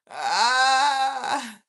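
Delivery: a sudden loud "Ah" of delight; loud excited cry. Real, not acted. Vlogger whooping over the benefits, a loud cry.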